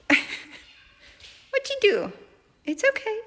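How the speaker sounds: high, slow cooing pet voice. Real, not acted. Owner soothing her startled new kitten in high baby talk.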